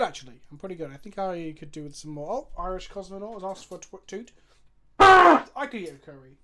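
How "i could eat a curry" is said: big, loud, hungry announcement; loud declaration. Real, not acted. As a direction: belt it out like a craving. Repeats line, first loud.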